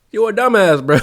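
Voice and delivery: high, loud jeering put-down; laughing insult. Real, not acted. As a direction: yell it at the fool in disbelief. Mocking the investor.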